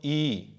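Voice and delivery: a long, slow ई with lips barely open; drawn-out vowel. Shown as a demonstration. Teacher demonstrating ई for the class, slowly drawn out. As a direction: stretch ई slowly to show the narrow mouth. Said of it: "उच्चारण करो, देखो".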